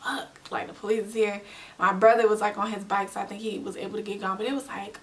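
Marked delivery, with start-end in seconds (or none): 0.0-1.4 panicked whisper. Retold or imitated. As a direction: whisper it as the police pull up. Panicked whisper as police arrive.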